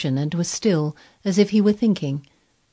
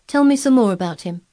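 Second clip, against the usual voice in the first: high, louder eager request; eager plea; 5 semitones higher, 6 dB louder than usual. A narrator's reading. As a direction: beg for more, keen. Sick boy begs for more.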